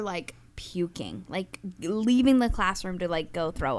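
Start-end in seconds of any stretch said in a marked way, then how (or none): 0.0-1.6 hushed whisper. Real, not acted. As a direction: drop to a whisper. Retelling classmates vomiting.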